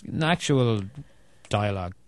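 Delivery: ordinary talk, nothing marked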